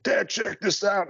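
high, drawn-out imitation of a bragging son; obnoxious show-off son. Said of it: "it's obnoxious because he's like".

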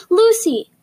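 high loud call of a name; shouting. Acted. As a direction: bark the name out suddenly. Frightening man shouting a name.

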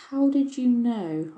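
stretched-out, doubtful fairy query; mistrustful. A narrator's reading. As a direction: draw the question out with suspicion. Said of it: "asked Dulcie mistrustfully".

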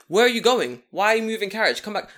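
retelling a loud call to a man leaving. Retold or imitated. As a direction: shout after him to come back. Calling after the fleeing man.

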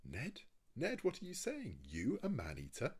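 high, loud incredulous questioning; shocked disbelief. A narrator's reading. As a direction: exclaim in horrified astonishment at your friend. Conseil shocked at Ned's cannibal talk.

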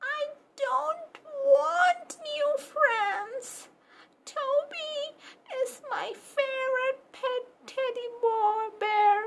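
high, whimpering puppy pleading; a scared little pup begging to stay home; tearful puppy. Acted. Puppy Pepper begs Mama not to send him to school, high and tearful.

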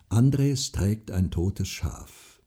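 plain reading voice

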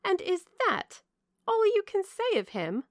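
put-on high-pitched, indignant young woman. A narrator's reading. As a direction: cry out in indignant disbelief. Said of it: "cried Marianne indignantly".

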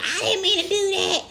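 very high mock-protest voice; mocking imitation. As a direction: mimic a friend's excuse way up high. Mimicking her friend's excuse.

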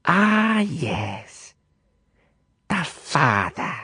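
high, slow, sneering; contemptuous. Acted. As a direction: spit out the father like an insult. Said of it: "as if it was a despicable insult".

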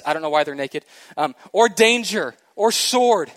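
high, loud, building to a climax; preaching at full force. Acted. Preacher builds Romans eight to a big ending for the church. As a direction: hammer each nor harder.

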